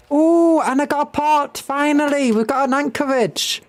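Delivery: high, delighted run of discoveries; excited. Real, not acted. Streamer finding a port and anchorage, excited and high. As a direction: let the pitch jump with glee.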